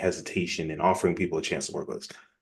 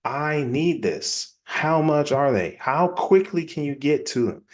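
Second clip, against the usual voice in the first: high, eager customer voice, words stretched out; hammed-up eagerness, pitched well up; acted excitement; 6 semitones higher, slower than usual. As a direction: play a buyer thrilled to hear the offer. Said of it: "They're gonna be so excited".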